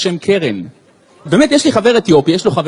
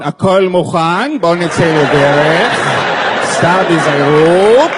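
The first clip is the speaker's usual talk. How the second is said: very slow, drawn-out GPS imitation; mocking the annoying satnav; annoying Waze voice; much slower than usual. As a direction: stretch every word like an irritating navigation voice. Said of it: "מהמעצבנת שיש עכשיו".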